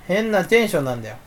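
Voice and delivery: normal talking voice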